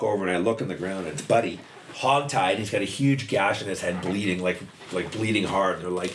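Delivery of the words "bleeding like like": usual speaking voice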